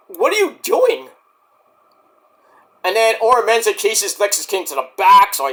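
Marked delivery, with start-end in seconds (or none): 0.1-1.1 exasperated. Retold or imitated. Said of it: "I was like".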